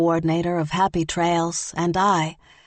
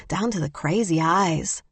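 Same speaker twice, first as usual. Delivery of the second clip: usual speaking voice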